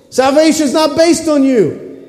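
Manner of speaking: preacher's forceful climax; emphatic point. Real, not acted. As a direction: drive the point home hard.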